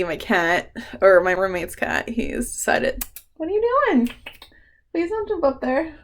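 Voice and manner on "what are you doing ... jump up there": much higher, coaxing voice for a cat; pet voice. Real, not acted. To the roommate's cat about to jump, in a high coaxing voice. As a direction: coo up high at the cat to stop it.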